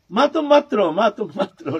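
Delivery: high, loud imitation of a crying child; mock-tearful plea. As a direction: plead like a child in tears, lightly acted. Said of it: "रो रो करके".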